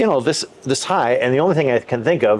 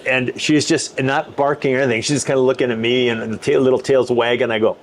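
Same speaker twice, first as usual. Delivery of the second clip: ordinary talk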